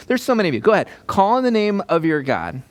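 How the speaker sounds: high-pitched, cocky challenge; swagger. Acted. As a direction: goad the rivals with swagger. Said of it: "a little bit of swagger in here".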